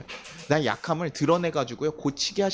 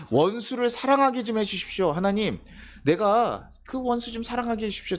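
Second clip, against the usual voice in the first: imitated earnest prayer; 6 semitones higher than usual.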